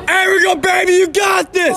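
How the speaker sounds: cheering shout. Real, not acted. Cheering teammate from dugout.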